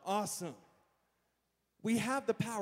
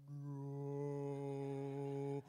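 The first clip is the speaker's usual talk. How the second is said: long, low drawn-out "no"; mock slow-motion horror; slow-motion cry; 7 semitones lower, 7 dB quieter than usual. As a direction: imitate a slow-motion yell, deep and stretched. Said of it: "then my life slowed down".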